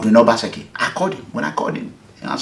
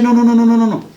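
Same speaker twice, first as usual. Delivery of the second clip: rapid higher no's, dismissive; firm refusal; 9 semitones higher, 3 dB louder, faster than usual. Retold or imitated. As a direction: brush him off with a stream of no. Governor refusing the request.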